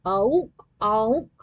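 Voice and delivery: nasal honking horn imitation; imitated honk; car horn. A narrator's reading.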